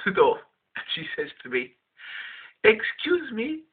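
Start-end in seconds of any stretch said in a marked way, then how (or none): 2.6-3.6 put-on high, polite East European accent. Woman at the car door asking for help, East European accent. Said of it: "in an East European accent".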